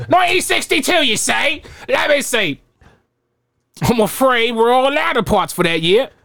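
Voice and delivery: put-on high, bright old shopkeeper voice. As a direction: play the chipper shop owner up high. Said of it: "I'll be the shopkeeper".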